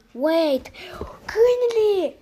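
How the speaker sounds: high, loud breathy burst of surprise; a startled whispered cry, remembering the dog; sudden realization. Real, not acted. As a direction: gasp it out as it hits you.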